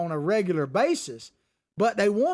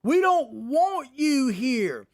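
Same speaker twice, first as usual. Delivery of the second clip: slow, drawn-out mock chant, a bit louder; retold protest shout; 5 semitones higher, 3 dB louder, much slower than usual. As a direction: imitate the protesters chanting at the diners. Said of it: "They're telling them to get the F out of here".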